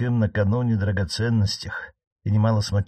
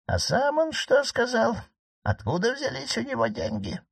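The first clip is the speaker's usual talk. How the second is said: old marquise, voiced higher, lightly ironic; 14 semitones higher than usual. Aunt teasing her nephew the judge, voiced higher. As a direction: needle the judge in a higher aunt's voice.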